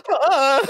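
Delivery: high, loud cartoon yelp of fright; imitated panicked cry, half laughing; hammed-up scream while fleeing; mock scared scream. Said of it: "He's running away and he's like".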